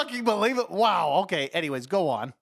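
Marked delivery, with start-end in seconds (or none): 0.0-1.2 mock amazement. Real, not acted. Mock shock at cursing.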